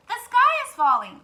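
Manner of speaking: loud, high alarmed cry; panicked cry. Acted. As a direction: shout the alarm together. Birds cry together.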